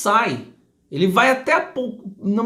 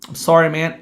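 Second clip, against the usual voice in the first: normal talking voice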